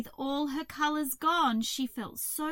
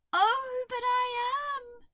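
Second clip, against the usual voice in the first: very high wailing protest; miserable crow; 13 semitones higher, 6 dB louder than usual. A narrator's reading. Crow covering her face, miserable. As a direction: wail it in a high bird voice. Crow insisting she is dull.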